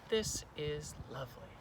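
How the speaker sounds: soft, half-whispered wonder; whispery, appreciative murmur; hushed admiration. Acted. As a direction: breathe it quietly, taking in the view. Teacher softly admires the spot.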